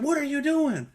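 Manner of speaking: wife shocked as the car hits them; alarmed outcry. Retold or imitated. Wife yelling at the driver who hit them, high alarmed outcry. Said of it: "My wife was like".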